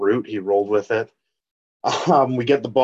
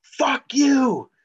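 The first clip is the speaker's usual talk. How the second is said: high, far-off mock scream at the TV. Fan screaming at the TV in overtime, high shout. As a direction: belt it out high like a fan losing it. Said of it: "I screamed the loudest".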